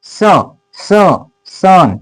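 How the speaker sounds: स, स, then सन, built up from the first sound; sounding out sun. Shown as a demonstration. Teacher sounding out सन for pupils, syllable by syllable. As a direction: break सन into its opening स, then say the whole word. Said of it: "सन म्हणजे काय?".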